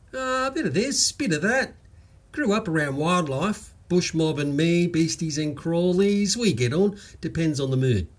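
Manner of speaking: high, easy-going Aussie bloke voice; lightly voiced Australian bloke; cheerful Queensland bloke. Waka answers.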